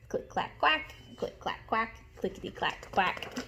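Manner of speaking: whispered click clack with quacks; hushed typing noises with quacks. Acted. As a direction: tap it softly then quack. Ducks typing.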